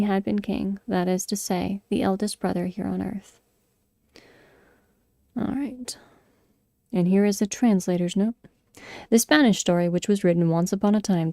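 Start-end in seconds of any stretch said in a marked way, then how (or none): 5.4-6.0 breathy whispered "all right". Real, not acted. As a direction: whisper it to yourself. Whispered aside.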